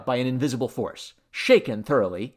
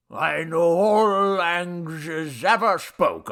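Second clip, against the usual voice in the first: very slow, loud slur; drunken boastful god; 6 semitones higher, 5 dB louder, slower than usual. A narrator's reading. Drunk god bragging about languages, slow slurring. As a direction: drag out the words like a bragging drunk.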